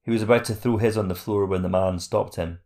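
ordinary delivery

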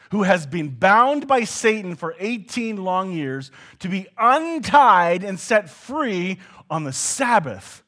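Jesus demanding an answer from his critics; loud rebuke. Retold or imitated. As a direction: hammer the final question at them. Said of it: "And here he says".

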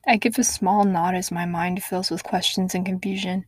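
usual speaking voice

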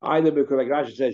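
ordinary talk, nothing marked

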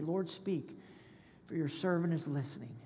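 soft, humble prayer to the Lord; hushed prayer. Pretend. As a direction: pray it quietly, as a listening servant. Quoted prayer of a listening servant.

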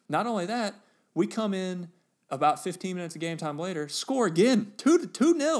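rising to a thrilled high shout; half-yelling the second goal in glee; buzzing with remembered joy, voice climbing high and loud; excited. Real, not acted.

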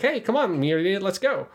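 nonchalant. Retold or imitated. Suletta calling Miorine after the killing, high and breezy. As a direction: call her over like nothing happened. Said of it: "She's just like".

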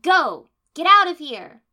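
very loud high shout; shouted order. A narrator's reading. The heroine ordering her team off, shouting. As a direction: yell them away.

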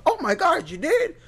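high-pitched gushing disbelief; mock amazement. Someone hears of his nonprofit, high mock amazement. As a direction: imitate an over-impressed fan, pitch way up. Impressed person's reaction, acted out.

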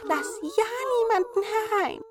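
hohe Zwergenstimme, besorgt; hoch und eindringlich warnen; warnende Zwerge. A narrator's reading. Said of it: "warnten es die guten Zwerglein". (hohe, besorgte Zwergenstimme)

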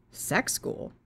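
hushed, breathy repeat of the phrase; puzzled whisper. Retold or imitated. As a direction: whisper it back in disbelief.

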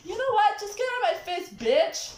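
high, loud yell at her brother; yelling across the room. Real, not acted. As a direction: shout her out of your face. Shouting at sibling.

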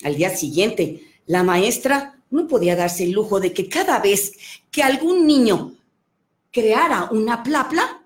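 lively, animated storyteller voice; playful children's storyteller; storytelling to children. Acted. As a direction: tell it like you're reading to a room of kids. Tells children's story on request.

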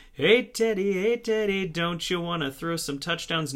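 mock taunt. Taunting the Broncos quarterback.

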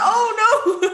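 high, laughing "Oh no"; mock Home Alone. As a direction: play the panicked cartoon victim. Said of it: "it's still like Home Alone".

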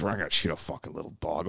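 a hint of a low, soft, offhand cop; dismissive cop. Cop brushing off the dog plea, low and offhand. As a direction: toss it off like a bored cop.

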